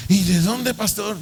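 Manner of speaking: high-pitched startled question; astonished. Retold or imitated. Sister to pastor told to pay rent, astonished. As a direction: blurt it out, baffled.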